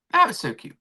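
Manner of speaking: usual speaking voice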